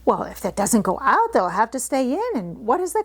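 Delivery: chatty country girl, lightly voiced; Martha's Yorkshire voice. Housemaid gossiping to Mary, lightly voiced Yorkshire.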